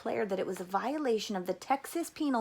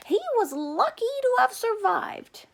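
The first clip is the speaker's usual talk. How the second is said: higher, louder, hopeful mother insisting; mother's voice; 13 semitones higher, 6 dB louder than usual. A narrator's reading. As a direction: play the mother defending the family. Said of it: "Stanley's mother was quick to point out".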